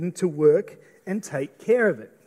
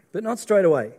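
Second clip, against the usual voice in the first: usual speaking voice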